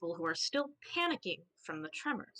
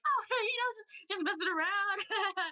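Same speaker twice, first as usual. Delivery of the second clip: much higher, sheepish fake-casual voice; nervous innocence; 11 semitones higher than usual. Bath caught snooping, squeaky fake-casual excuse. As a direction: play it off like nothing happened. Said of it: "Bath is like".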